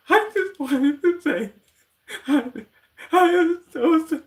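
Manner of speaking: mock sobbing sorry, hammed-up; fake crying voice. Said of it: "the whole fake crying voice".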